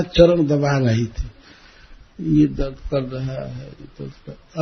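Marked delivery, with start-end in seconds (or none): 2.4-4.6 soft mock moaning about pain; understated put-on suffering; feigned aches. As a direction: murmur the complaint, fading away. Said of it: "खूब अपने दुख का वर्णन किए".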